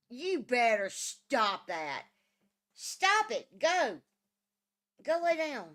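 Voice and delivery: scolding. Real, not acted. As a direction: snap at them to knock it off. Scolding pets or kids in room.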